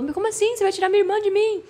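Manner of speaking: high, fast hurt protest; pleading not to lose her sister; upset little sister. Retold or imitated. Irmã em quase depressão protestando. (irmã aflita, voz aguda e rápida)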